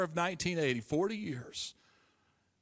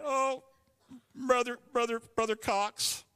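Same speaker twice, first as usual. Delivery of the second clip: tearful plea; 8 semitones higher than usual. Retold or imitated. Crying apology to dean.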